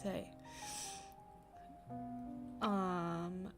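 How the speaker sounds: ordinary delivery, nothing marked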